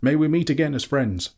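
high, hopeful parting call; cried farewell. A narrator's reading. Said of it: "he cried to them".

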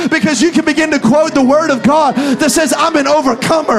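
loud, rising pulpit preaching; full-force preaching. Real, not acted. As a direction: preach it hard and build to a roar. Continued preaching build.

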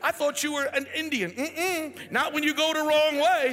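high, loud mock tirade; ticked-off GPS. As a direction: rant indignantly as a fed-up navigation lady. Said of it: "All ticked off".

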